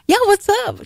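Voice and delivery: bright, very high hello; chirpy phone greeting. Retold or imitated. Mom answering a friend's call in the pickup line, high and chirpy. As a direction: answer the phone all cheery.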